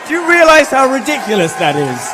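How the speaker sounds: high, very loud disbelief; incredulous shout. Real, not acted. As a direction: call it out over a roaring crowd. Exclaims over the cheering crowd.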